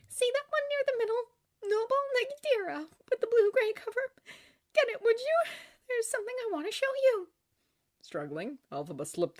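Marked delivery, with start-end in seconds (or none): 0.1-7.3 put-on high-pitched young woman after crying; high, fragile character voice; girlish Glinda, subdued. A narrator's reading. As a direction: ask your friend in a high, small character voice.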